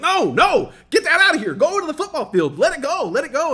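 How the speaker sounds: high, loud, frantic shooing; panicked orders. Retold or imitated. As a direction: shoo the bat away in a panic. Panicked teacher ordering bat away.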